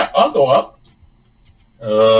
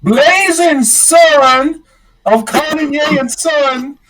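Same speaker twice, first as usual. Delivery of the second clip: high, drawn-out proclamation; louder true name; 12 semitones higher, 4 dB louder, slower than usual. Acted. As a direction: belt the name out high and very slowly. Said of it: "your true name is louder than that".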